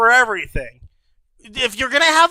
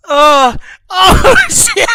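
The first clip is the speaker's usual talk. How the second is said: laughing; 7 semitones higher, 5 dB louder, slower than usual. Real, not acted. Laughing at own singing.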